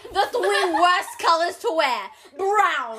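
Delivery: put-on slow, loud Italian accent through laughter; imitated Italian accent. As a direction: enunciate like the Italian TikToker, amused. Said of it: "his Italian accent".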